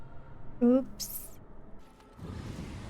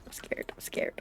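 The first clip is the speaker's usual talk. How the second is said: mock-frightened whisper, much softer; whispered scared; 11 dB quieter than usual. Player whispering to companions in mock fear. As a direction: hush it like a nervous kid.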